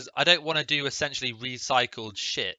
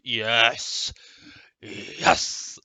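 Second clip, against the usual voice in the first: excited yes; 4 dB louder than usual. Real, not acted. Emphatic answer to the survey.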